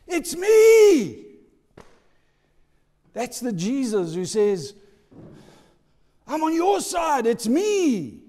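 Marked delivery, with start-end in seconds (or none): 0.1-1.3 high, loud cry of "It's me"; loud emotional reveal. Acted. Joseph reveals himself in tears. 6.3-8.2 high, loud, urgent promise; Jesus telling a frightened believer, high and fervent; fervent reassurance. Acted.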